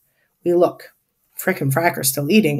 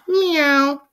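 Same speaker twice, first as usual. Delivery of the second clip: high, drawn-out meow; crying cat; 7 semitones higher than usual. A narrator's reading. Trapper cries from under hedge, crying cat. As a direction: mew pitifully like a cat. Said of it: "she cries".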